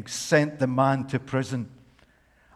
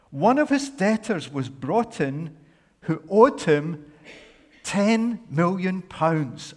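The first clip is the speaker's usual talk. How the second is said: ordinary talk, nothing marked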